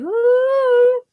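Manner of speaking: lightheaded ooh. Retold or imitated. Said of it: "I feel like".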